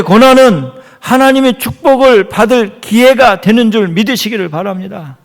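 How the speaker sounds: loud, high pulpit exhortation; forceful preaching. Real, not acted. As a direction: drive the promise home to the congregation.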